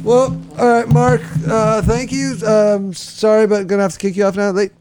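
a hint of a high host voice; lightly voiced host announcement; host announcer voice. Host wrap-up voice.

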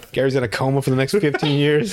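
normal talking voice

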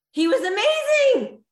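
big, high, loud and slow burst of praise; excited praise. Real, not acted. Host hyping a guest to the audience, excited shout. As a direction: hype him up to the crowd.

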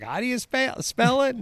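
high-pitched mock dad asking; dad's question. Dad asks how the podcast is spelled, high mock voice. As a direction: imitate a puzzled dad, voice shooting up. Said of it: "Dad's over there going".

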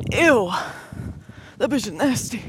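normal talking voice

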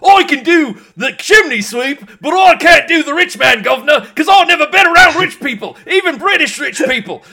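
loud, high mock-Cockney shout; bad Cockney. Chimney sweep who can't do rich people, Cockney. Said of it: "your version of a Cockney accent".

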